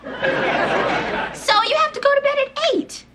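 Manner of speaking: high, slow taunting echo; mocking comeback. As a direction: throw it back at her in a high sing-song. Mimics sister's jab back at her.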